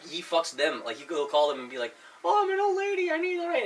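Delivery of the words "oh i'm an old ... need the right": put-on much higher, thin old lady voice. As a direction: play a helpless little old lady. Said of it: "he'll pretend he's getting robbed".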